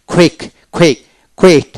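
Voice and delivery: "কুইক, কুইট" opening on a puffed KH; aspirated Q. Shown as a demonstration. Teacher demonstrating phonetic Q in quick and quit, as KH. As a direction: say the first sound of "কুইক" and "কুইট" as খ. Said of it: "খ উচ্চারিত করলে".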